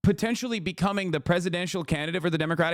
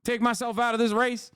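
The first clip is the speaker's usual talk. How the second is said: put-on higher, stubborn old-man rant; defiant Biden impression; 6 semitones higher than usual. Streamer mocking Biden refusing to quit the race, defiant and high. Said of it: "he's just like".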